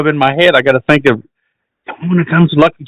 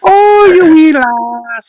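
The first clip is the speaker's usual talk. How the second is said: put-on high, drawn-out Scottish accent; Scottish attempt; 8 semitones higher, much slower than usual. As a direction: copy a slow, high Scottish coo. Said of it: "you're getting it".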